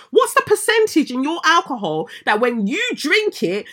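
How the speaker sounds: high, loud incredulous outrage; outraged. Real, not acted. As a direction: rant at them in disbelief. Outraged rant at excuse.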